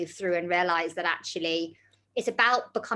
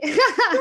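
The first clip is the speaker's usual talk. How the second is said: short high laugh; high burst of laughter; 13 semitones higher, 9 dB louder than usual. Real, not acted. Host laughs at apology.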